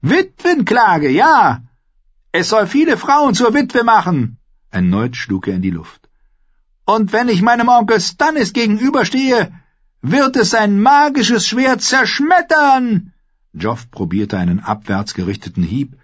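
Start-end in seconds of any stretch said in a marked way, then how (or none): 0.0-4.4 put-on high, loud boy king; high-pitched, bloodthirsty royal boast; exultant calling out. A narrator's reading. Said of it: "rief er". 6.8-13.1 bloodthirsty high-pitched boast; boastful boy king. A narrator's reading.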